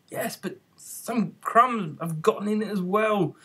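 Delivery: slow, grumbling complaint. A narrator's reading. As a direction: grumble it slowly. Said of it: "the Hatter grumbled".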